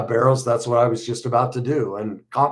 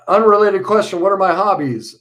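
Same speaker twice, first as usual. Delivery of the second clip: bright topic change; 9 semitones higher, 8 dB louder than usual. Real, not acted. Abrupt topic switch after tears.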